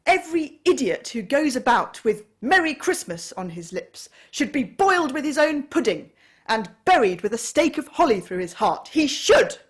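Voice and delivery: loud, high indignant roar; indignant outburst. A narrator's reading. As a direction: rage at every merry idiot. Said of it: "said Scrooge indignantly".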